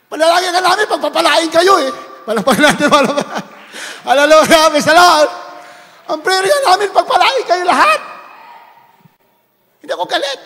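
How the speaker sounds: loud, rising preacher's call ending in a shouted Hallelujah; rally the church to get back up; preaching at full force. Acted. Preacher urging sinners to rise, full-force preaching.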